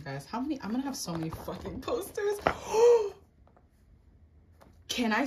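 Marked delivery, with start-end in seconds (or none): 2.4-3.1 sharp high gasp of delight; excited gasp. Real, not acted. Fan gasps at her album poster, thrilled. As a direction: gasp like you just saw the best thing.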